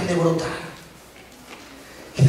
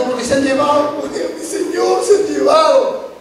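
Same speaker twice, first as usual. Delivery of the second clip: higher-pitched, acted grieving plea; tearful lament; 5 semitones higher than usual. Mary telling Jesus they took her Lord, lamenting. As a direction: plead like a mourner, acted. Voices the weeping woman's plea.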